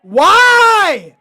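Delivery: high, loud wail of protest; loud, high-pitched pleading yell; dismayed shout. Real, not acted. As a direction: cry out in exasperated disbelief. Dismayed reaction to the video.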